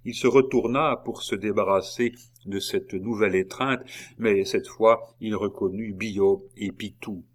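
ordinary delivery, nothing marked

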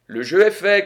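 high, loud table announcement; croupier's cry. A narrator's reading. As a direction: call out that betting is closed. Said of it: "cria le croupier".

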